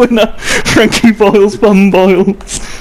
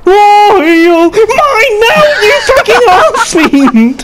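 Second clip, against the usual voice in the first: excited shout; 9 semitones higher, slower than usual. Real, not acted. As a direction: shout it out gleefully.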